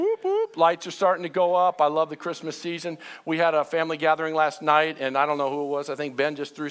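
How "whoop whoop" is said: high-pitched cheering whoops; celebratory whoop. Pretend. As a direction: whoop it up playfully for Christmas. Cheering for upcoming Christmas.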